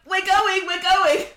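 high, loud, hurried reassurance; flustered high-pitched we're going; panicked quote. Retold or imitated. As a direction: squeak it out like you're caught overstaying. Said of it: "we both turned round and we were like".

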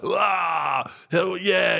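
hammed-up crowd cheer; mock cheer. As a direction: whoop like a rowdy crowd. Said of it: "thinking that the Yanks were gonna be like".

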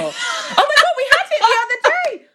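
high, fast, laughing rush of words; excited laughing. Real, not acted. Friend launching into a story on the podcast, excited and laughing. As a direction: burst out with it, giggling.

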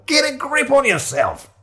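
very high, slow, emphatic rebuke; exasperated scolding. Acted. Shiloh to companion spooked by a fox, exasperated. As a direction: scold a jumpy companion, drawn out.

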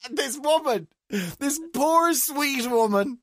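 high, slow, drawn-out lament; exasperated self-reproach. Retold or imitated. Man confessing shouting at poor cleaner, high anguished retelling. Climax of his wound-up retelling.

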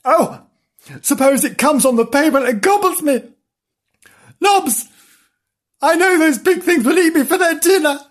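high, frantic kitten voice; hammed-up high dread; panicking kitten. A narrator's reading. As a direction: fret loudly in a squeaky pitch. Frightened kitten panics about cars.

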